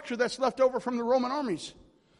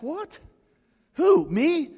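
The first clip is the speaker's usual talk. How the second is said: high-pitched disbelief, retold; incredulous questions, jumping up high; astonished; 5 semitones higher, 5 dB louder, much slower than usual. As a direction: act out being startled at being picked. Retells astonished reply to God.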